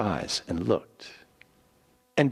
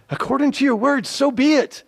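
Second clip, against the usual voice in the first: high bright agreement; 5 dB louder than usual. Acted. Rahab agrees to the oath.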